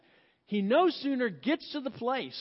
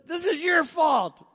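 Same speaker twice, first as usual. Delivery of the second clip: a hammed-up accusing shout, louder and higher; mock shouted blame; 7 semitones higher, 7 dB louder than usual. Sarah blaming her husband Abraham in a mock angry shout. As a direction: throw the blame hard in a mock yell.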